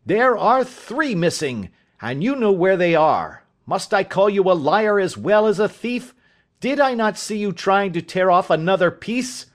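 high, forceful, hammering questions; acted angry father confronting his son; furious accusation.

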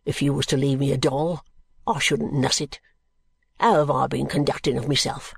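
low, rough-spoken working man, defiant; low-pitched, dialect-heavy, contemptuous brickmaker; surly drunken brickmaker. Acted. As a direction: growl out a sullen answer to an unwelcome visitor. Brickmaker's rant voiced within the narration.

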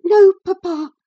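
high, loud gasp of distress. A narrator's reading. Daughter answering her papa in shock, gasping. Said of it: "she gasped".

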